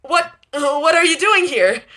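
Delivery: high squeaky startled voice; squeaking. A narrator's reading. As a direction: squeak it out in alarm. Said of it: "She, she squeaks".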